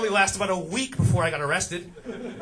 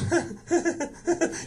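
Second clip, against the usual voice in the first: mock laugh; 10 semitones higher, 3 dB quieter than usual. Father-in-law reacts to can.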